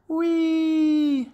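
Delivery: very high, sustained squeal; gleeful whee. A narrator's reading. Young frog enjoying the flight, high gleeful squeal. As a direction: let out a long high whee. Young frogs love flying.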